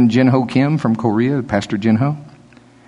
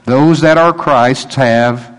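slow emphatic; 3 dB louder, much slower than usual. Shown as a demonstration. Slow emphatic scripture quote.

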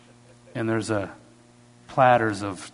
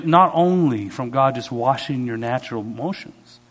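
ordinary talk

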